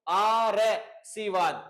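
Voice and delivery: आशीर्वाद said very slowly, syllable by syllable; slow teaching pronunciation of आशीर्वाद; careful pronunciation. Shown as a demonstration. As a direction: spell out आशीर्वाद slowly, r before व. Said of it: "इसको आप यदि बोल के देखें तो देखो".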